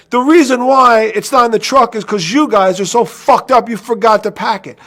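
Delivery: loud, high furious rant; angry yelling. Retold or imitated. Manager yelling at his crew over the phone, loud. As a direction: yell at the crew in fury. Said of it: "I go".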